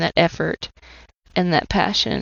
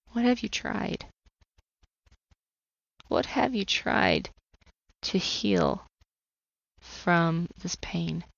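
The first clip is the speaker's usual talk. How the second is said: softer, tender address; lovingly calming a hurting child; gentle voice of God; 7 dB quieter, slower than usual. Retold or imitated. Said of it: "heard very audibly the words".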